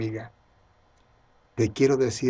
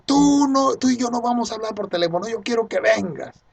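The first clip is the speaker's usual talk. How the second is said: high-pitched, agitated mock yell; retold yelling; 10 semitones higher, 3 dB louder than usual. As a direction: act out a caller's heated demand. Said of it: "me grita".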